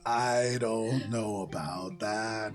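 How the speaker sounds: slow, low, coy voice; shy and flattered, drawing it out; low, slow blushing creature; bashful snake lady. Acted. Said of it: "she blushes too, and she just goes".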